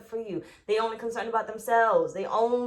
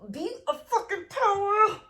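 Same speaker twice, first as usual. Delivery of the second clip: fired up; 9 semitones higher than usual. Real, not acted. Fired up urging viewers.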